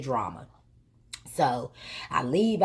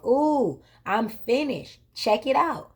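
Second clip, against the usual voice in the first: proud stylist; 6 semitones higher, slower than usual. Retold or imitated. Stylist shows off finished braids.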